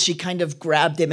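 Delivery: ordinary talk, nothing marked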